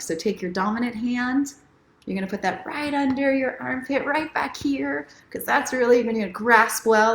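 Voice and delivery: walk them through it tenderly; gentle guided instructions. Acted. Guiding viewers through the self-hug, gentle.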